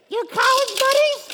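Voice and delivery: shrill, slow falsetto outrage; hammed-up wife, very high and loud; mock wife voice. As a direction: screech it back slowly as the appalled wife. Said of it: "This is my best voice".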